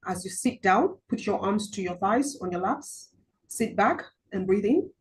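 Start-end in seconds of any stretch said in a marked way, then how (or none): none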